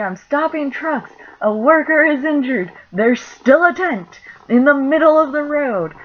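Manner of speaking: high and loud, urgent; frantic radio call. Retold or imitated. Coworker in the street radioing the store, a frantic loud call. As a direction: shout the report while waving trucks off. Coworker shouting on walkie-talkie.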